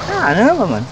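usual speaking voice

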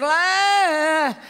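high, drawn-out imitated wail; a toddler straining to get a word out; imitated child's struggling cry. Father imitates his son trying to speak, high wail. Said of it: "He's trying his best".

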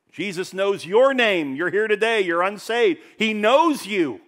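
fervent altar-call appeal. Real, not acted. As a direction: press it home on the unsaved listener. Direct appeal to unsaved.

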